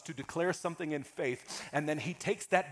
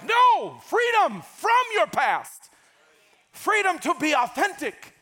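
loud, high shouted proclamation; preaching shout; 10 semitones higher, 6 dB louder than usual. Real, not acted. Pastor shouting to the congregation, loud and high. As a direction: shout it out to the church.

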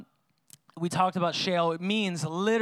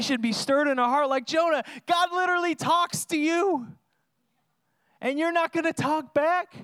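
real pulpit fervour, pitched up; fervent preaching; 7 semitones higher than usual. Real, not acted. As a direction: plead with the congregation at full force. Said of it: "I'm here yelling things".

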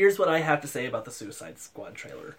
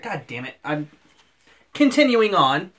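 loud, higher, exasperated cut-off; grumpy; 3 semitones higher, 7 dB louder than usual. Real, not acted. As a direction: push past the teasing in a huff.

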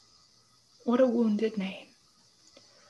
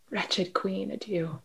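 faint dying farewell; 2 semitones lower, 9 dB quieter, slower than usual. Acted. Dying Hamlet bids farewell.

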